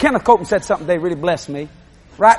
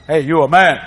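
ordinary talk

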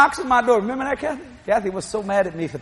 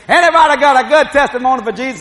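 louder preacher calling to the pews; preacher's call; 4 semitones higher, 6 dB louder than usual. Retold or imitated. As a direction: draw out testimonies from the crowd. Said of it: "That's what the preacher said".

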